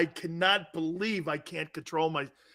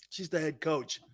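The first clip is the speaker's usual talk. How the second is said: normal talking voice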